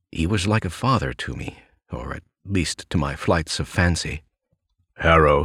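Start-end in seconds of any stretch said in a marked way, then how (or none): 5.0-5.4 lightly voiced old editor, louder; a hint of the old boss addressing his writer; gruff editor. A narrator's reading. Editor's quote voiced in narration.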